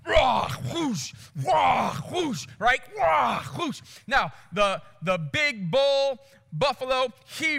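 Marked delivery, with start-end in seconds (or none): 0.0-2.5 loud roar, then a rushing whoosh; explosive roar-and-swoosh sound effect; imitated pouncing lion. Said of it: "all of a sudden they're like". 3.0-3.8 loud roar, then a rushing whoosh; explosive roar-and-swoosh sound effect; imitated pouncing lion. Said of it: "all of a sudden they're like".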